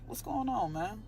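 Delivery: ordinary talk, nothing marked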